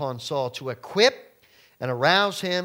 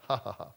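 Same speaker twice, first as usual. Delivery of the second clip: mock gloating laugh; 4 semitones lower, 5 dB quieter, faster than usual.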